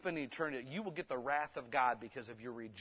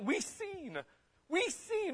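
high testimony; slower than usual. Real, not acted. Preacher testifying.

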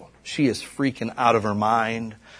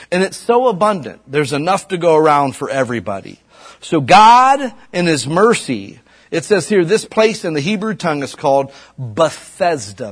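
loud, high preaching to the congregation; louder, higher revival preaching; full-force preaching; 6 semitones higher, 7 dB louder than usual. Acted. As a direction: preach it out, building with fire.

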